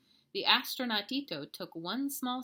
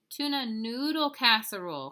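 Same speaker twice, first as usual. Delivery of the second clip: mildly firm motherly correction, a little raised; correcting mama; 4 semitones higher, 7 dB louder than usual. Acted. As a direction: gently set the child straight. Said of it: "corrected Mama".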